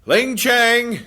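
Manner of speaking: high loud call; sharp shout. Acted. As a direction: summon the cook. Husband calling the cook.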